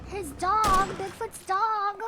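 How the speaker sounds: high and loud, slowing on "Bigfoot's dog"; excited discovery. Pretend. Gamer spotting Bigfoot's dog, loud and high. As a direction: announce the find with glee.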